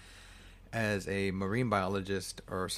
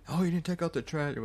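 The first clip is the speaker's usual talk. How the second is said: higher-pitched nagging complaint; mildly mock annoyed; 7 semitones higher than usual. Wife scolding husband over the trash, mock annoyed. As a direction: imitate a partner's petty scolding. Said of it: "she gets mad at him".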